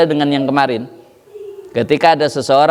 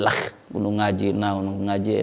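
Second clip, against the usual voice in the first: low mocking grumble; 8 semitones lower, 10 dB quieter than usual. Quoting the defiant refuser.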